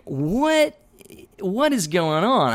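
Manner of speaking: a sudden high, baffled what, then the question; high, puzzled double take; startled what. Retold or imitated. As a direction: hit What way up in disbelief, then slow down. Finds Josh bent over in woods.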